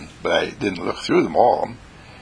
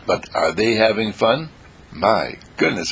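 usual speaking voice

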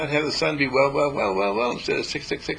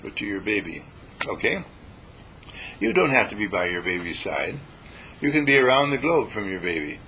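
usual speaking voice

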